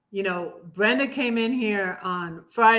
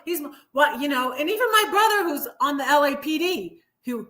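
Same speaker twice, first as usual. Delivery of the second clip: normal talking voice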